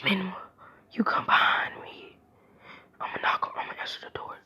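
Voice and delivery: voiceless whisper, keeping it secret. Retold or imitated. Naruto whispering orders to Menma at the door. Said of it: "he whispers and he says".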